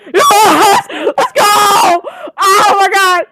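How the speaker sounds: very high, loud victory shouting; real fan scream; triumphant cheering. Real, not acted. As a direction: cheer like your side just won.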